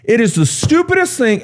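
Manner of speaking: high-pitched, hammed-up furious rant; mock yelling back. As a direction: act out a boss yelling back in exasperation. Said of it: "now he's yelling back at this resident".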